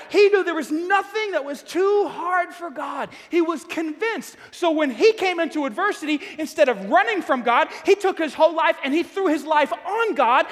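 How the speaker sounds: loud, fervent preaching. Acted. Pastor preaching hard to the congregation, fervent. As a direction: build the sermon to a loud fervent pitch.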